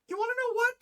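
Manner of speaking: very high mock indignation; imitating a worked-up friend, high-pitched; retold exasperated rant.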